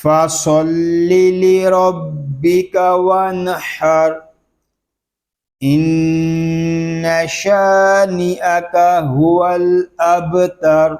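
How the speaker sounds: slow, melodic tilawat with held endings; Quranic recitation. Shown as a demonstration. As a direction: recite the whole surah in chant. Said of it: "پوری صورت کو ہم تلاوت کرتے ہیں". (پوری سورت کی تلاوت)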